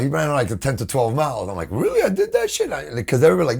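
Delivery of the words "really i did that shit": high, astonished "Really?"; incredulous. Retold or imitated. Singer hearing how far he ran, stunned and high. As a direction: squeak it out in disbelief. Disbelief at running six miles.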